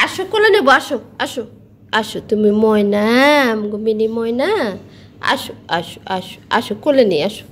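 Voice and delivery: cooing come here, come here to a pet; coaxing a pet bird. Real, not acted. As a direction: coax the little bird onto your lap. Coaxing the pet myna again.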